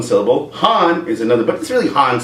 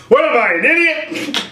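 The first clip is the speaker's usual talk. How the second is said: laughing retort; 10 semitones higher than usual. Retold or imitated. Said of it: "And I told her".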